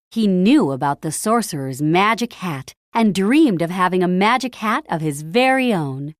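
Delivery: ordinary delivery, nothing marked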